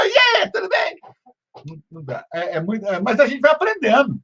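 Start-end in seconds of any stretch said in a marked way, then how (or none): 0.1-1.0 bust in on the chat yelling hi; shouted greeting. Retold or imitated.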